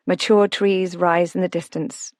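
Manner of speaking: ordinary delivery, nothing marked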